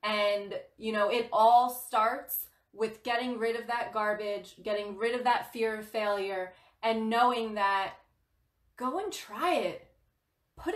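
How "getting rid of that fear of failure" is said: ordinary talk, nothing marked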